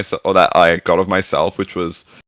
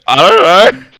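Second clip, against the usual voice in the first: panicked blurt; 10 semitones higher, 8 dB louder than usual. Retold or imitated. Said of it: "'Cause I started shitting myself".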